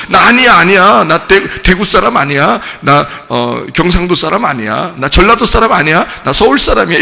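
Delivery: mock Seoul speech to hide a regional accent; fake Seoul accent. [name] insisting to the crowd he is from Seoul, in a put-on Seoul accent. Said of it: "갑자기 서울말 쓰면서".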